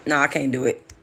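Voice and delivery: usual speaking voice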